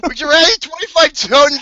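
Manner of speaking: breaking up with laughter, loud; laughing, crying voice. Real, not acted. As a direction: squeal it out through laughter. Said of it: "I'm queasing right now".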